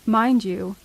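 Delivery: ordinary delivery, nothing marked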